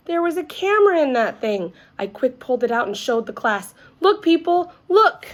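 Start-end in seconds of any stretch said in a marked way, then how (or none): 4.0-5.3 high, loud excited call. Acted. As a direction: show it off to everyone. Showing the camera to the class.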